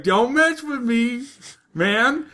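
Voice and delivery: high-pitched don't mess with me; squeaky disbelief. Retold or imitated. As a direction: push it up into a cracking yelp. Bodyguard refuses to believe.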